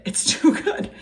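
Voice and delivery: high laugh through the words. Real, not acted. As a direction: break into a laugh on it. Repeats it, laughing.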